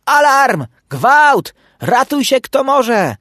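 high, loud cries of panic; frantic shouting for everyone to flee; desperate alarm call. A narrator's reading. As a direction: raise the alarm in despair. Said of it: "wołał rozpaczliwym głosem".

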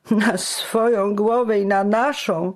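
high tearful complaint; whining. A narrator's reading. Fabio adds a tearful complaint to Giano. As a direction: moan it, close to tears. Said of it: "dodał płaczliwie jeszcze Fabio".

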